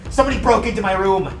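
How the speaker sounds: alarmed inn guest. Acted. Game master plays a guest coming downstairs, alarmed. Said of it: "one of which saying".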